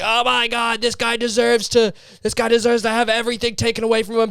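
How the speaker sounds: lightly voiced, fast, mock-indignant internet commenter; mock outraged internet user. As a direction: parody a self-righteous online scold. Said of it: "how they're just like".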